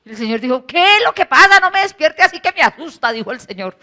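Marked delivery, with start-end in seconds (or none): 0.7-3.1 mock startled. Lord voiced, startled awake.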